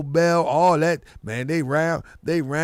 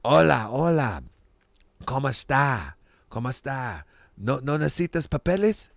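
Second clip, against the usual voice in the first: lightly voiced Spanish-speaking clerk, lower; clerk greeting his brethren; 4 semitones lower, 3 dB quieter than usual. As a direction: imitate a low-key clerk chatting in Spanish. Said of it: "he talked to his brethren".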